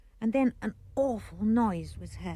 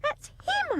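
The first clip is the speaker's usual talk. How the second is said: excited cry. Acted. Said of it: "I cried".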